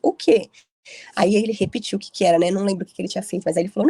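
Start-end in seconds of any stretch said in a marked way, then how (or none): 0.0-0.5 surprised. Retold or imitated.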